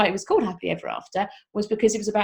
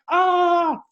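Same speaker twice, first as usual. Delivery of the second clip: high, loud exasperated groan; worn out by endless whys; parent's mock exasperated cry; 10 semitones higher, 7 dB louder than usual. Parent exasperated by questioning child.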